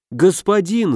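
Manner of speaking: high-pitched, mildly wailing appeal; wailing plea. A narrator's reading. Mystic defends himself to the angry legate, wailing plea. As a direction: beseech your master. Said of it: "запричитал мистик".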